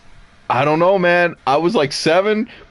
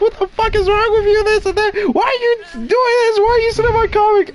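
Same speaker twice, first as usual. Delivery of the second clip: yelling mom. Retold or imitated. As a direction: imitate a scolding mother way up high. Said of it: "she's yelling up a storm".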